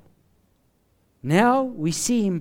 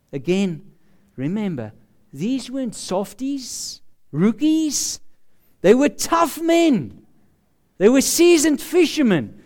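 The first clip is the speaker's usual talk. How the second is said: normal talking voice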